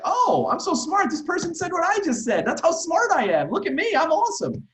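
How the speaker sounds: very high, loud, hammed-up boastful kid voice; smug kid. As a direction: play a show-off child bragging, squeaky and loud. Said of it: "They're gonna be like".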